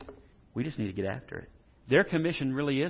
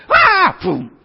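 sudden high, loud "ah" and a boom; mock dunking yell; 18 dB louder than usual. Preacher pretends to dunk people at the pool, mock dunking yell. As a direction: yell out a playful dunk sound effect.

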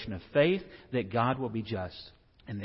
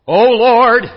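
very loud, high cry to heaven; hammed-up revival prayer shout; boisterous praying; 12 semitones higher, 22 dB louder than usual. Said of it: "boisterous point of view of praying".

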